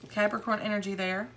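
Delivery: usual speaking voice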